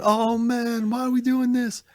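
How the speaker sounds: high whiny groan of despair; whiny complaint. Pretend. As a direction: whine it like a tired hero. Imagined trailer line, high whine.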